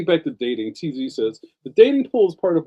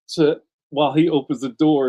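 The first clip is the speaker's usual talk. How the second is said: ordinary talk, nothing marked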